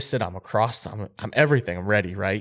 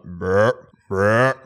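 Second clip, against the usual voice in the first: imitated deer grunt; 5 dB louder than usual. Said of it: "I'm trying to sound like a".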